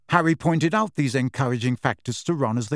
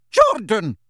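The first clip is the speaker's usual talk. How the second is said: sharp, loud rebuke; yelling; 7 semitones higher, 6 dB louder than usual. A narrator's reading. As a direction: yell the name to cut him off. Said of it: "yelled Professor McGonagall".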